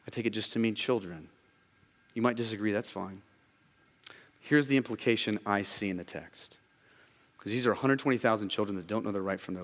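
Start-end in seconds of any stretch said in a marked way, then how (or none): none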